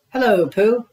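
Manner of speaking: high, small piglet voice; squeaky Piglet. Acted. Piglet greeting his friend Pooh, high and squeaky. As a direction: pitch way up for a tiny nervous pig.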